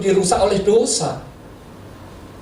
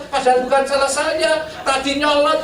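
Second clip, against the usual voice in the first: usual speaking voice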